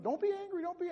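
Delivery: high-pitched, mimicked telling-off; mock scolding. Voices people forbidding anger.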